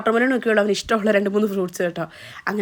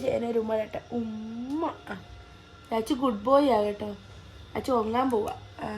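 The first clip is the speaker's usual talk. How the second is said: soft lullaby tone; 2 semitones lower, 11 dB quieter, slower than usual. Real, not acted. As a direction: softly settle the little one down. Soothing toddler to sleep.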